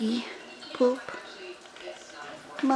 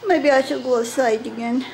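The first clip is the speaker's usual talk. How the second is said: usual speaking voice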